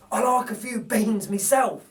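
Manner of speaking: a hint of satisfied relief; relieved burglar. Narrator voices Bill relieved the baby laughs. Bill's voice in read story.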